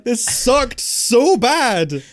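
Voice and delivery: really snapping over the film; real exasperation, loud and high; exasperated rant. Real, not acted. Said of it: "I'm sorry, I've snapped".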